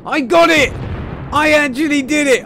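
loud, high victory yell; triumphant shout. Real, not acted. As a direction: cheer the win at full voice. Triumph after kill.

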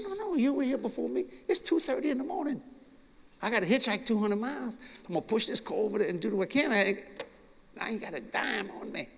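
a light take on the kind stranger at the pump; voicing the stranger. Voices the stranger's offer.

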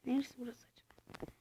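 puzzled soft whisper. Real, not acted. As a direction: whisper the question to yourself. Kendi kendine soruyor. (şaşkın fısıltı)

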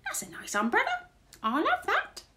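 very high, rising intruder voice; squeaky new burglar character; burglar's voice. A narrator's reading. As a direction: coo over loot in a high voice. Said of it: "a voice says".

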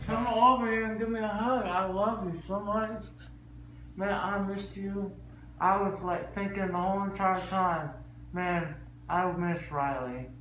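after wisdom teeth. Real, not acted. Groggy tender talk to child.

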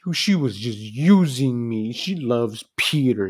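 hammed-up whiny crying; mock crying whine. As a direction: ham up a spoiled son whining to his father. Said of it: "goes crying to his dad".

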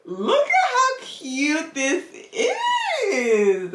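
high, slow, tearful gush over a cute gift; squealing with joy; overjoyed gushing. Real, not acted. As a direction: melt with delight, almost crying. Opening a surprise gift, squealing.

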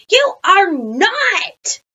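high, loud, drawn-out contradiction; hollered protest. A narrator's reading. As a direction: holler it slowly like a whole class. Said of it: "we all hollered".

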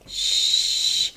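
long hushing "shh"; drawn-out shush. Pretend. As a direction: hush the whole room. Hushing the others at night.

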